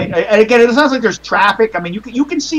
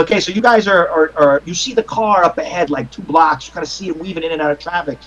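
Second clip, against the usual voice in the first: usual speaking voice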